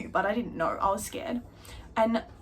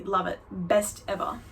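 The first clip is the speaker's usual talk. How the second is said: normal talking voice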